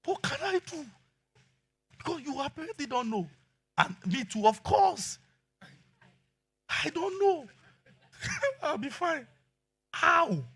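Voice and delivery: high laughing mock of the wife; high giggling wife impression. Mocking wife's confusion, laughing.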